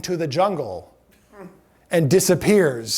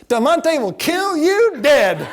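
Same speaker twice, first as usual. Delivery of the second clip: put-on slow, higher, louder child voice; child's sing-song; 6 semitones higher, 4 dB louder, much slower than usual. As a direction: chant it like a proud seven-year-old. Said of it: "She goes".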